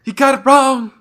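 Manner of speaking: very high, loud sing-song taunt; Bray Wyatt. Acted. Telling his co-host he is wrong, sing-song Bray Wyatt impression. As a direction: crow it out high and loud in character. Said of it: "kinda got sing-song".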